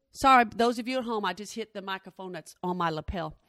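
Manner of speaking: usual speaking voice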